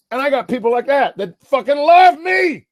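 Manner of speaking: high, drawn-out, impassioned shouts; emotional shouting. Real, not acted. Man telling internet haters about loyal friends, real emotional shouting. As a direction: insist on it at the top of your pitch. Overwrought, insisting people love him.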